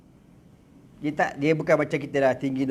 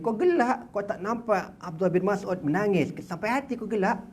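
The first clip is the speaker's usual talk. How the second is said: reproachful Prophet; 7 semitones higher than usual. Acted. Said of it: "Nabi kata".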